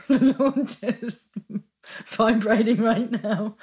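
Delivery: real helpless laughter while talking; overcome with laughter. Real, not acted. Feeling the presence of the Lord, overcome with laughter. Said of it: "I can feel the presence of".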